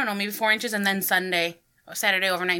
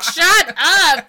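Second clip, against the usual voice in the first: yelled at sibling; 6 semitones higher, 7 dB louder than usual. Retold or imitated. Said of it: "my sister was like".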